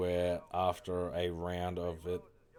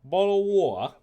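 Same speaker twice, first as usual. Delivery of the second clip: "bottle of water" much higher and louder, hammed up with the T dropped; exaggerated British accent; 12 semitones higher, 13 dB louder than usual. Shown as a demonstration. Streamer exaggerating his British accent, loud and high. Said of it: "I full on say".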